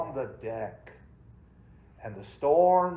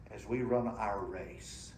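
usual speaking voice

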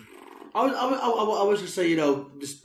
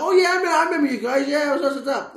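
high bright greeting; excited; 10 semitones higher, 4 dB louder, faster than usual. Retold or imitated. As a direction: greet old friends eagerly. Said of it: "I was like".

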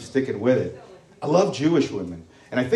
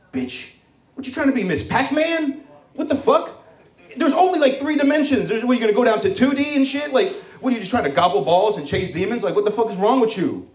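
mock outraged rant, pitched much higher; 8 semitones higher than usual. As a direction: squeal the disbelief like a scandalized friend. And I'm like.

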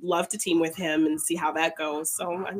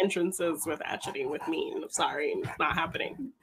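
quiet; 3 semitones lower, 11 dB quieter than usual. Real, not acted. Joking refusal after laughter.

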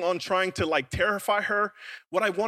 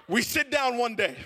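normal talking voice

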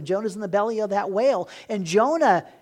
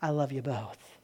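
gentle God; 5 semitones lower, 9 dB quieter than usual. Retold or imitated. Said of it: "And God's like".

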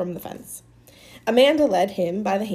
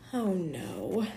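quiet refusal; 8 dB quieter than usual. A narrator's reading. Maniac refusing softly.

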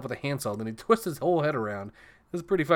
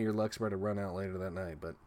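ordinary delivery

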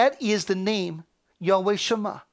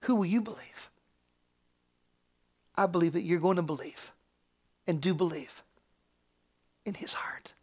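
low, soft, reverent appeal; gentle, hushed assurance; hushed preaching; 3 semitones lower, 6 dB quieter than usual. Acted. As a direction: sink toward a whisper, pausing between phrases. Voices God's perception, then appeals softly.

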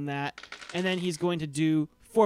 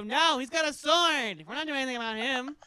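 nervous refusal; 7 semitones higher than usual. Acted. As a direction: back away from the fight. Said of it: "They go".

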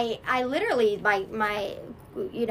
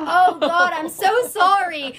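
louder, higher, slowed apology to God; remorseful outcry; 7 semitones higher, 8 dB louder, slower than usual. Retold or imitated. Ashamed she cries out her apology to God, loud and slow. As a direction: break out in sorry tears. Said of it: "And I would just".

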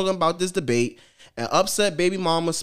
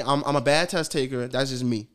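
ordinary delivery

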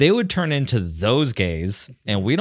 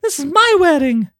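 high, loud mock bride wail; high loud hammed-up bridal whine; mock bride dismay; 6 dB louder than usual. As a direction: whine like a bride whose wedding is ruined. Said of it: "And you're like".

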